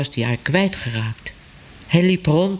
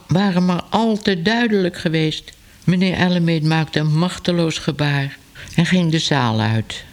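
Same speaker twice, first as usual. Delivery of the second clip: ordinary delivery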